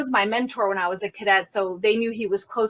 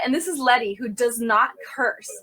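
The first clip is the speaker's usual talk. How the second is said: ordinary talk, nothing marked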